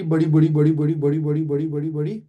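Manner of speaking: chanted run of one word, loud then tapering; rapid-fire repetition. Shown as a demonstration. Teacher shows students velocity growing and growing, in rapid-fire repetition. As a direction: run the word together in an unbroken chain, starting loud and easing off. Mimics velocity steadily increasing.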